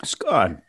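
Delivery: "Scone" repeated to rhyme with "gone"; short-O scone. Shown as a demonstration. Host copies caller's pronunciation, "Scone" rhymed with gone. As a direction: echo "Scone" with an open AH vowel. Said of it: "I'm gonna say scone until I'm corrected".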